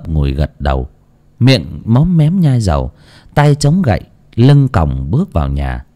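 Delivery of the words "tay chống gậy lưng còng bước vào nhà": usual speaking voice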